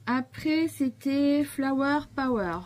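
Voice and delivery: high, slow, sing-song reveal of the name; bright, drawn-out presentation of Flower Power; upbeat theme announcement. Real, not acted. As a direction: announce the theme like a little fanfare. Announcing the next card theme.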